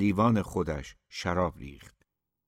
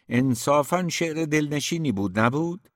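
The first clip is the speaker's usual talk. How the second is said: higher-pitched, rising tag question; appealing protest; 7 semitones higher than usual. A narrator's reading. Mirzayan defending the poem to Mikael, appealing protest. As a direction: press for agreement, lifting at the end.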